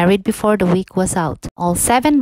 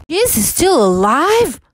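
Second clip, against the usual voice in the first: higher, strained furious queen; queen in a rage; 8 semitones higher than usual. A narrator's reading. Enraged queen questioning the mirror, furious. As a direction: spit the question out in disbelief. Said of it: "almost bursting with rage".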